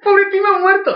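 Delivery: lamento agudo exagerado; llóralo con un gimoteo de broma; llanto fingido. Pretend. (sollozo teatral de burla)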